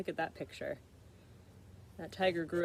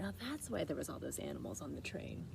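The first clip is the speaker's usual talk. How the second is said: usual speaking voice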